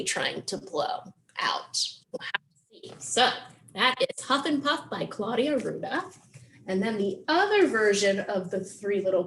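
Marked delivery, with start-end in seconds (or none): 0.0-2.9 a hushed, mysterious whisper. Acted. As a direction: whisper it like a secret. Teasing the book's twist.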